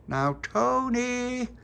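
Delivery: high, slow call through a letterbox. A narrator's reading. The children shout Tony's name through the letterbox, high and slow. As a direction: call Tony through the door, drawn out.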